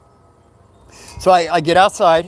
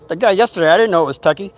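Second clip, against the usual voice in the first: ordinary talk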